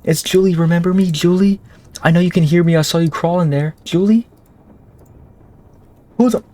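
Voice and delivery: high, eerie female character voice; sing-song creepy woman calling; woman's voice. Acted. Voices Julie in game.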